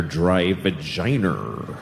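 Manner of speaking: "vagina" drawn out as "va-JYE-ner"; low, slow "vagina" with an -er ending; Boston pronunciation. Acted. Comic listing drugstore goods, Boston-style "vagina" ending in -er. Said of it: "they call the Boston accent".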